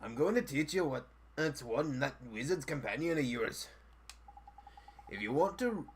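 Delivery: put-on cheeky fairy in a Welsh accent; sing-song Welsh lilt, full of mischief. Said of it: "I can't fucking do the accent".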